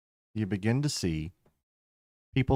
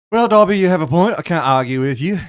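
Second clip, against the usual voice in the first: loud, higher Jack Sparrow-like concession; big, raised pirate-ish voice; tipsy pirate vampire; 7 semitones higher, 11 dB louder than usual. Acted. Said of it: "he sounds like a pirate".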